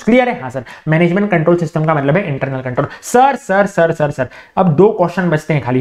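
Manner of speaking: ordinary talk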